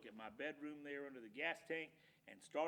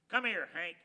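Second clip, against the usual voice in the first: loud stern call; stern command; 3 semitones higher, 11 dB louder than usual. Acted. Loper sternly calling Hank over, loud command. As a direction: order the dog over.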